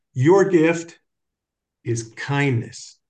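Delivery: slow, weighty pronouncement, first words loud; quoting Father. Retold or imitated. Father leaning from his throne, slow grave pronouncement. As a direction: deliver it like a solemn verdict. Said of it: "he leans forward and he goes".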